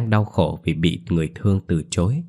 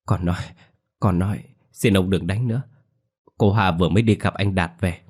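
ordinary delivery

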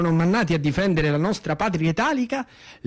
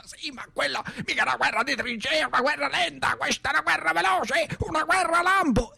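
excited arguing; 8 semitones higher than usual. Acted. Socialist arguing back.

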